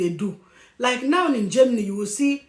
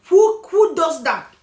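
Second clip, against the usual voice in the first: higher, louder and slow, in outraged disbelief; exasperated disbelief; 7 semitones higher, 8 dB louder, slower than usual. Real, not acted. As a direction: demand it slowly, disgusted that anyone would do this. Ranting about Nigerians' asylum tricks.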